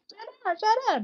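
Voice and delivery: high sharp barked "Shut up, shut up"; harsh mock snap. Shown as a demonstration. Sister telling her listeners, a sharp mock snap. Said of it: "I'm not saying it like that".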